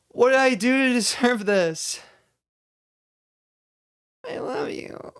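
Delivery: overacted, high-pitched loud plea falling into a thin whisper; slow, high, overwrought lovers' feud climax; bad acting. Shown as a demonstration. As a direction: ham it up like a tense bad actor. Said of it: "A bad example".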